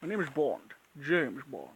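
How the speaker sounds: a light go at Connery's Scottish Bond; lightly done Scottish spy line; Sean Connery impression. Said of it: "Sean Connery, yes".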